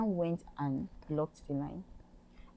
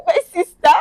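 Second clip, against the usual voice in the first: laughing; 13 semitones higher, 17 dB louder than usual. Real, not acted. Laughing at her own message.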